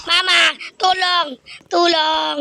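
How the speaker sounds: high panicked calls for help; yelling for help. Acted. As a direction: yell for your mom, scared. Chased by red dogs, calling.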